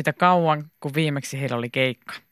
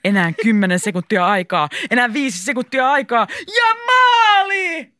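building from raised voice to long goal scream; rising sports-commentator frenzy; excited match commentary; 7 semitones higher, 5 dB louder than usual. A narrator's reading. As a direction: count down louder, then yell the goal.

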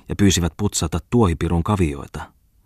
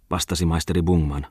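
ordinary delivery

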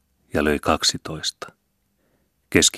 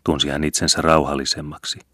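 usual speaking voice

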